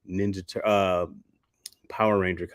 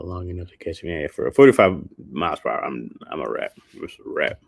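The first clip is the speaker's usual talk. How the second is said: ordinary talk, nothing marked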